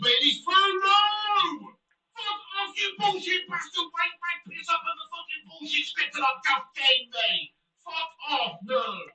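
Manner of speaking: high, loud, swearing rage at the game; furious rant. Real, not acted. Streamer raging at the game after a late goal, furious yelling. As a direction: explode at the screen, spitting each curse.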